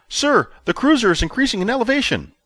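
officer reporting to his commander, high and tense; nervous junior officer. A narrator's reading. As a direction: report urgently like a rattled subordinate.

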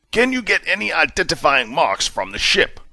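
clipped commanding officer tone; hint of a stern commander demanding answers; commander Justice, lightly voiced. A narrator's reading. Commander asks.

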